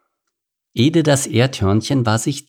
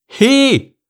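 much higher, loud sharp scream at a worker; shrill shout; 10 semitones higher, 4 dB louder than usual. A narrator's reading. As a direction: shriek at the clumsy one. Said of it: "spitzen Schrei der Chefin".

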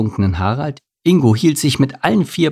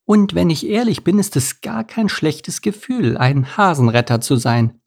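high-voiced thrush, modestly pleased; thrush character, confiding; 7 semitones higher than usual. A narrator's reading. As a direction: admit it in a small bird's high voice.

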